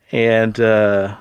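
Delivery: ordinary talk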